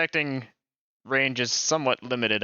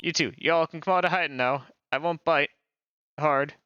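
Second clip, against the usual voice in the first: put-on Southern accent. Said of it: "The accent is so cliche".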